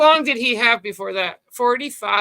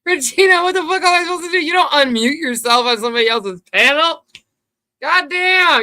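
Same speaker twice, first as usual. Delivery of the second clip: loud high exasperated outburst; exasperated yell; 7 semitones higher, 6 dB louder than usual. Real, not acted. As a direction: blow up at her in disbelief. Exasperated at guest unmuting himself.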